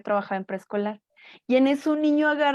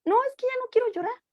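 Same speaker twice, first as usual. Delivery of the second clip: put-on child's voice; 13 semitones higher, faster than usual. Child's voice acted.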